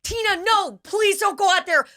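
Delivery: high, pleading mock coworker voice; panicked pleading coworker. Coworker begging Tina not to go outside, high pleading. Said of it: "she's like".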